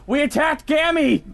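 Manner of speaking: high-pitched mock-horrified wail; dismayed outcry. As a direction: cry out in horrified realization. Player cries out in dismay.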